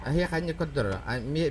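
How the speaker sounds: usual speaking voice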